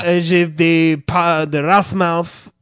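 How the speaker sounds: slow, loud fake-French gibberish; fake foreign language. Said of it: "pretending to be fucking foreign".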